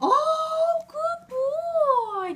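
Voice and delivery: high, loud delighted praise; excited praise. Real, not acted. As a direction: squeal praise at the dog. Praising dog for saying mama.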